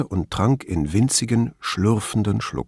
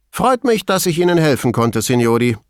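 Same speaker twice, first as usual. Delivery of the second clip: high, hearty parting words; Veltrini, brisk farewell; 6 semitones higher, 4 dB louder than usual. A narrator's reading. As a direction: toss off a goodbye, pitched up. (forscher Abschiedsgruß, hohe Stimme)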